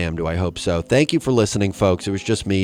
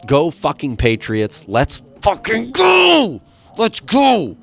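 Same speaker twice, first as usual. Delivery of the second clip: high, slow roaring cheer; excited shout; 9 semitones higher, 6 dB louder, slower than usual. Real, not acted. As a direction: yell it like a fan. Cheering for Patriots.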